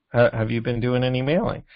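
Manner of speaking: ordinary talk, nothing marked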